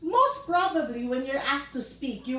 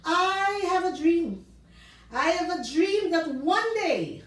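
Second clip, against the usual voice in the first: higher, with more projection and pauses; bigger-crowd delivery; 5 semitones higher than usual. Shown as a demonstration. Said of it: "See the difference?".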